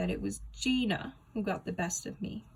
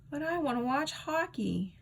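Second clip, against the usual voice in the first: whine; 7 semitones higher than usual. Acted. Whining kid.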